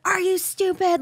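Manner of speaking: high, loud mock scream of an angry mom. Mom yelling at her drugged daughter, mock scream. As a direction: shriek it like a furious mother. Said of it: "Like, screaming at me".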